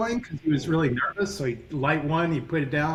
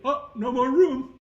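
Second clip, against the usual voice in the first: put-on New York accent; 11 semitones higher than usual. Professor writing grades at the top of the board, in a mock New York accent, fake-surprised. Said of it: "I'm gonna do his accent".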